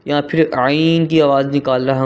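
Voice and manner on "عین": عین with a tight pharyngeal onset, held long; mid-throat عین. Shown as a demonstration. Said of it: "گلے کے درمیان والے حصے سے". (عین گلے کے درمیان سے)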